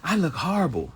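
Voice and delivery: high, loud whiny put-down; mock self-pity. Mimicking women who run themselves down, high and whiny. Mocking people putting themselves down.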